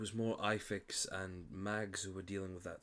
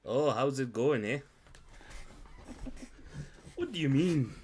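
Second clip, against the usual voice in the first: put-on Canadian accent; 6 semitones higher, 7 dB louder than usual. GM tries a Canadian accent on the spot, hammed-up. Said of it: "that's my best Canadian accent".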